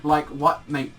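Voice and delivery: ordinary talk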